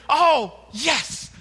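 high, loud thrilled disciples; excited disciples. Retold or imitated. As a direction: burst with relief. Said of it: "they're so excited".

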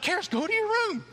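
high, firm order; stern scold. Retold or imitated. Dad sending toddler to her room, high stern order. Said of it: "I'm like".

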